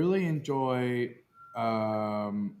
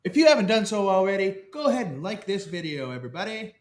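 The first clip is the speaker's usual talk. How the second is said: high, loud mock announcer; 7 semitones higher, 4 dB louder than usual. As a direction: pitch it like a host. Announcer plug.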